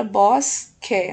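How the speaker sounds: BOSQUE split as BOS... QUE; two separate beats on BOSQUE; syllable by syllable. Shown as a demonstration. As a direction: hold the S in BOSQUE, then QUE apart. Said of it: "a primeira sílaba também termina".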